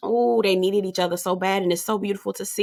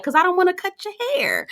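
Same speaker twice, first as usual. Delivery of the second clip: much higher and quick, lightly mock-sweet; imitating a sweet man; 12 semitones higher, faster than usual. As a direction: toss it off high and fast. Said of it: "he was like".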